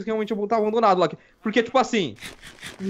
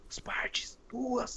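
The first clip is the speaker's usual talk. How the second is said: very soft, whispered report; 15 dB quieter, much slower than usual. Real, not acted. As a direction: whisper the small victory. Quietly repeats loot news.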